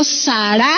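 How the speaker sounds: Sara with the accent on its last A; stress on final A. Shown as a demonstration. Preacher teaching the Hebrew name, Sara stressed on final A. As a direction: stress the second syllable of Sara, sa-RA. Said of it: "con acento en la última A".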